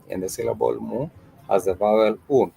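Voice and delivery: usual speaking voice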